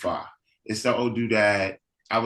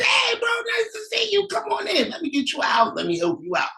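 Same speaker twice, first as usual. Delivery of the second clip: excited welcome. Acted. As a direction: gush like you finally see family. Warm greeting of brother.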